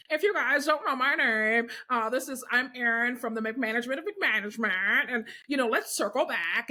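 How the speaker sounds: mocking corporate-speak character voice; Corporate Erin. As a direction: parody a jargon-loving corporate employee. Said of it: "she's mocking, like, real people in corporate America".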